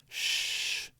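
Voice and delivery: long soft "shh"; imitated hushing. Classmate secretly shushing him in class, hushed. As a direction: hush them with a drawn-out shh. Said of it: "the amount of whispering that's going on".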